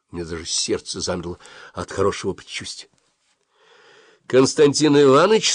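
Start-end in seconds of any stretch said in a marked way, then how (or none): 4.2-5.5 bright, affectionate, higher call on the phone; sweet ringing voice. A narrator's reading. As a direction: greet him sweetly and warmly. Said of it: "ласковым и звонким голосом". (ласково и звонко)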